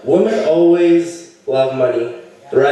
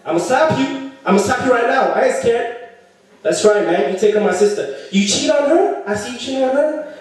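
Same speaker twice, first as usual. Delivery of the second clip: threatening brother; 6 semitones higher than usual. Retold or imitated.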